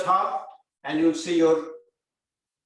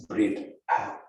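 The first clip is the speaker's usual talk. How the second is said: guided breathing; slower than usual. Acted. Yoga teacher counting breaths for the class.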